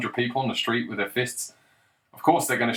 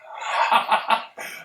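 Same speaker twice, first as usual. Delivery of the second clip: amused laugh; 11 semitones higher than usual. Real, not acted. Laughs at own scenario.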